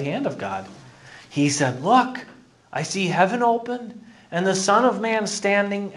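high, lifted narrative scripture reading; scripture read aloud. Acted. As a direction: read the vision out with rising emphasis.

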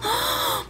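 sharp high gasp of delight; excited gasp. Real, not acted. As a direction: gasp in surprise. Gasp at fan letter.

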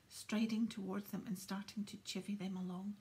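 ordinary delivery, nothing marked

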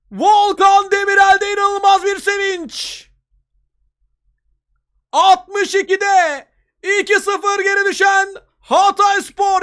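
high, loud, slow excited shouting; screaming football commentator. Real, not acted. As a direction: keep shouting the comeback, drawn out. Excited commentary after the goal. (coşkuyla bağıran spiker)

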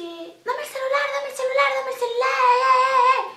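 mock drugged woman slurring for the phone; slurred junkie voice. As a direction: mumble it like someone too high to speak clearly. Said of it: "No se le entendía un sorungo".

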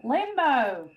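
Limbo in an attempted American accent, ending on a rounded "oh". Shown as a demonstration. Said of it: "Try an American accent".

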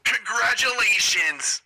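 high, drawn-out congratulations; celebratory, stretched-out delivery; prize announcement. Acted. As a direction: announce a winner over the phone.

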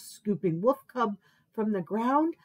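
usual speaking voice

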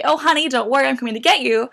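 ordinary talk

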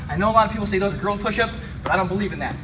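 ordinary delivery

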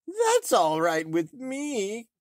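high, slow crone voice; disguised old woman. A narrator's reading. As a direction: croak it sweetly as a disguised hag. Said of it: "answered the farmer's wife".

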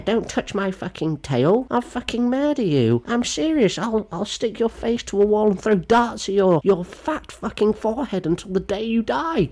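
very high, squeaky threats; hammed-up hysterical rant in falsetto; mock furious student. Mocks the tail wearer making threats.

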